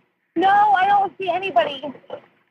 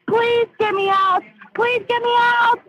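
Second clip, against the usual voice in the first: panicked pleading; 7 semitones higher, slower than usual. Real, not acted. Panicked caller begging rescue.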